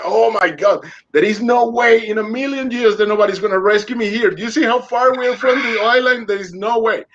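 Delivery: high, frantic protest; desperate protest. Retold or imitated. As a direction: argue back in panic. Arguing with the voice, desperate.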